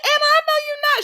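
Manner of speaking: very high, laughing squeal; tickled, high-pitched comeback; amused mock disbelief. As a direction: squeal the retort through giggles. Cracking up while retelling her retort.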